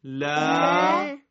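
لا recited loud with a long held aa; recited syllable. Shown as a demonstration. Teacher leading the class reciting لا loudly. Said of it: "این کلمات رو بخونید همه با هم".

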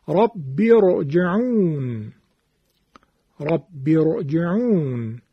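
slow recitation of رب ارجعون with thick R; heavy R. Shown as a demonstration. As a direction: draw out رب ارجعون, keeping both Rs heavy. (تلاوت کشیدهٔ رب ارجعون با تفخیم راء)

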